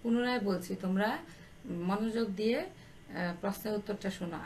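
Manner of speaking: ordinary talk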